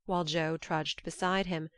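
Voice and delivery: ordinary delivery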